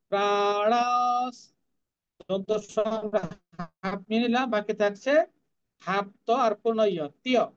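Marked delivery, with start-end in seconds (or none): 0.1-1.5 "প্রাণস" slowly intoned with a nasal "oun" before the s; nasalised vowel. Shown as a demonstration. Teacher showing the nasal sign, "প্রাণস" drawn out. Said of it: "তারপর দন্ত স্ব".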